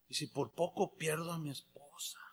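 whispered, nearly voiceless confession; tearful whisper. Retold or imitated. Husband confides to pastor he almost lost his wife, whispering. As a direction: breathe it out as if barely able to speak. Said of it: "Y él lloraba y decía".

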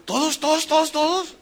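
high, incredulous repeated question; doubting todos. Retold or imitated. Preacher mimics the congregation's disbelief, incredulous. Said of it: "así como diciendo".

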